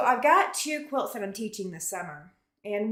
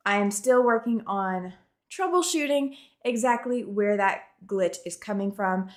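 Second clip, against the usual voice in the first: ordinary talk, nothing marked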